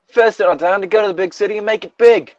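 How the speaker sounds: high, put-on urging voice; fiery redhead. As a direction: pitch it high and cheer her on. Redoes line as the redhead.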